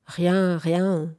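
mildly sullen brush-off; a touch moody, falling away. A narrator's reading. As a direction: dismiss it with understated sulkiness. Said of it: "dit-elle maussade".

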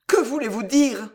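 high, bewildered question; gasped question. A narrator's reading. Girl no longer knows what to believe, bewildered gasp. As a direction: ask as if short of breath. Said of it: "haleta la jeune fille".

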